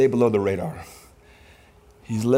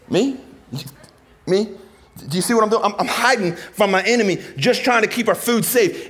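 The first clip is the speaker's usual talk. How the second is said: high-pitched, disbelieving protest; incredulous Gideon; 9 semitones higher, 3 dB louder than usual. Acted. Gideon answering God's praise from hiding, incredulous. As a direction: squeak out a baffled self-defence. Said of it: "Gideon's like".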